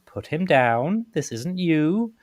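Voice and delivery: high, slow, drawn-out appeal; acted pleading, rising in pitch; urgent plea. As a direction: plead to make the attacker stop. Urgent plea to stop the attack.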